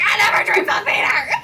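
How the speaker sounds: laughing indignant protest. Acted.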